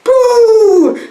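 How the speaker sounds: long high "boo" blast; loud, high drawn-out launch noise; imitated whoosh. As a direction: hoot the blast, held high. Imitates being blasted out of airlock.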